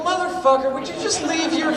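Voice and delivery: high, loud exasperated outburst; exasperated yell. Retold or imitated. Snapping at the neighbor, loud and high. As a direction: blow up at him, loud and high. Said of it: "I go".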